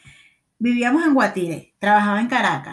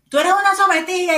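higher, louder teasing jab; mocking taunt; 7 semitones higher, 4 dB louder than usual. Teasing a friend who won't come to the river, mocking and higher. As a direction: needle her like a mocking friend.

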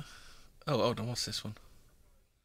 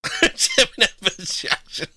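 slow words through a laugh; 10 semitones higher, slower than usual. Real, not acted. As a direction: laugh out the name. Laughing at the suggestion.

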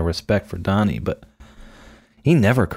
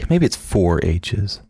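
ordinary delivery

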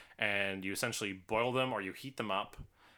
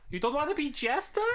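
much higher, louder cutesy squeak; high squeaky voice; 6 dB louder than usual. Retold or imitated. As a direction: squeal it like a cartoon. Mocking Jester's voice.

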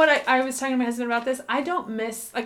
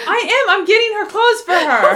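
indignant laughing protest; 10 semitones higher, 10 dB louder than usual. Real, not acted. Defending herself, laughing.

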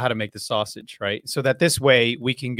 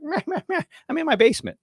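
mocking blah blah; 13 semitones higher, faster than usual. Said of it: "I'm like".

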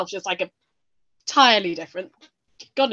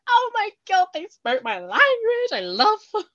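high, loud, starstruck squeal; mock-gush like an adoring crowd; gushing fan; 12 semitones higher, 5 dB louder, slower than usual. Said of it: "everyone's gonna be like".

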